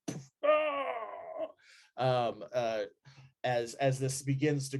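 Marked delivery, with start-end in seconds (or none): 0.4-2.3 a sharp high yelp of alarm; startled cry. Acted. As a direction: cry out as if burned.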